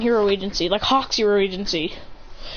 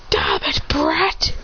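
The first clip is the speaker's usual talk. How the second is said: growled curse; much slower than usual. Acted. Defeated villain curses.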